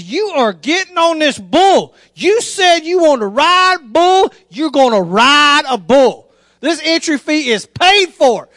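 slow, emphatic fatherly order; stern insistence. Retold or imitated. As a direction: hammer each word home to a reluctant son.

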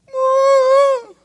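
high, loud woo; cheering whoop. Retold or imitated. Prisoners whooping instead of fighting, loud whoop. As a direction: let out a big whoop.

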